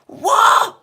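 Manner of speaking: a jolted cry of disbelief; shocked what. Real, not acted. Reacting to a viewer who says she cries, shocked.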